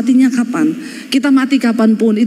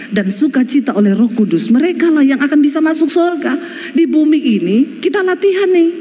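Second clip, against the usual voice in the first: usual speaking voice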